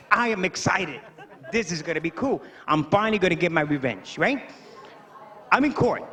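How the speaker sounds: usual speaking voice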